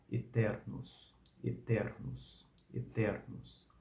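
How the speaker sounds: "eternos" slow and soft, each syllable clear, open E; ecclesiastical Latin. Shown as a demonstration. Teacher slowly models the word for students. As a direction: say "eternos" slowly three times, carefully. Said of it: "que eu vou pronunciar três vezes".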